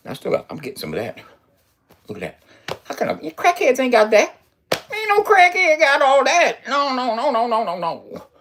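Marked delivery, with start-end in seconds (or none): 2.8-8.3 mock crackhead voice. Mocking crackheads for lacking his muscles, high put-on voice. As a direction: ham up a jittery crackhead answering back.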